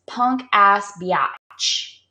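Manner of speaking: each word stretched very slowly; slowly spelled out. Shown as a demonstration. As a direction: drag out every word. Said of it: "it says".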